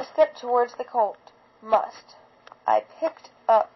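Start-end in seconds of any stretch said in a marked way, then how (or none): none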